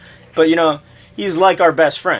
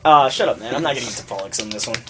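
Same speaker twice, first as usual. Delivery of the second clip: ordinary talk, nothing marked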